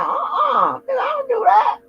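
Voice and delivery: high, loud retold shout of refusal; acting out an indignant no; mock refusal. Telling off a guy who asks for something, mock shout.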